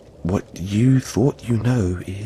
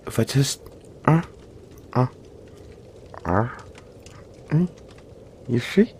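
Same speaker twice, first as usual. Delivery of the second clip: usual speaking voice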